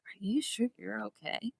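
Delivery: soft whispered concern; imitating his soft, whispered concern; gentle whisper. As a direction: whisper it kindly. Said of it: "he's like".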